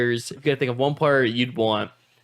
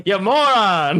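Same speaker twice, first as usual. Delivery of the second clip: higher-pitched mock coach insult; Jim Jam Flim Flam; 5 semitones higher, 4 dB louder, slower than usual. Podcaster imitating coach berating his boxer, mock scolding. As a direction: playfully scold in a put-on coach voice. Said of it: "his voice would be funny to hear".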